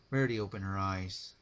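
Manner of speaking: usual speaking voice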